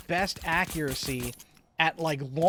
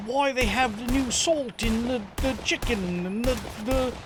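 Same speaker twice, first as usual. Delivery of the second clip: higher-pitched, badly faked British accent; half-hearted British chef accent, pitched up; Gordon Ramsay accent; 5 semitones higher than usual. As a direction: attempt a British chef's complaint, accent not quite landing. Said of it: "I can't do that accent".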